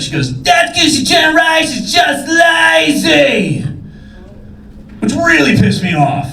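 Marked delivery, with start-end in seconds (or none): 0.4-3.6 high, drawn-out heckler's screech; mock scream. Elderly lady in front row heckles comic, mock screaming. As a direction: imitate an old lady screeching, stretching every word. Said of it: "the elderly lady just screams out".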